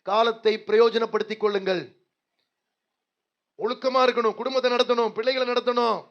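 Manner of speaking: loud exhortation. Acted. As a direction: press the point home to the church.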